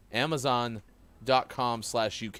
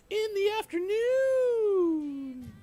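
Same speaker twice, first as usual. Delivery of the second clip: high voice; much slower than usual. Acted. Intro to afternoon viewers.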